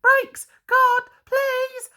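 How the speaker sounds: very high, loud urgent cry; urgent Percy call. A narrator's reading. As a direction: shout to the guard for brakes. Percy calling to the guard.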